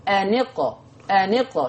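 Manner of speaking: أنقة بوصل النون بالقاف; linked nun into qaf. Shown as a demonstration. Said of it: "حتى أنت لاحظها في نطقك".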